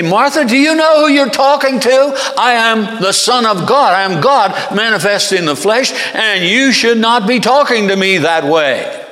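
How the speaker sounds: loud, high, outraged pulling of rank; mock indignant tirade; indignant rebuke. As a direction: thunder back at an insolent challenger. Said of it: "No, he says calmly".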